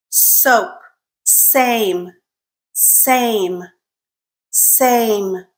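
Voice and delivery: Sun, Sink, Soap, Same each said alone with a clear opening S; careful isolated words starting on a clean S; word-initial S drill. Shown as a demonstration. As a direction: model each word for a learner, pausing after it. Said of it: "I'll say the word three times".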